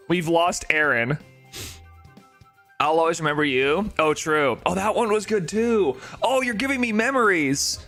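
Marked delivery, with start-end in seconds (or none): none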